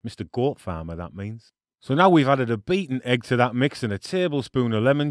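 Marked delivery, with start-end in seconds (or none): 0.0-1.5 dropped low and much softer, a throwaway translation; quiet deadpan punchline; deadpan aside. Acted. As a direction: mutter the joke under your breath. Jokey aside translating the name.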